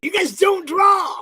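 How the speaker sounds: high, loud mock-indignant shouting; mock outrage. Host to guests crashing the draw stream, mock outrage.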